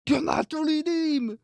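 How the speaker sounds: high, drawn-out protest; high-pitched mock plea; mock outcry. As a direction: cry it out high and slow, hammed-up.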